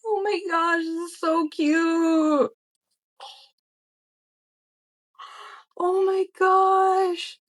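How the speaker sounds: high, slow, drawn-out gasps of delight; high squeaky awe over a cute surprise; delighted squeal. Real, not acted. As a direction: squeal softly at something cute. First sight of the package gift.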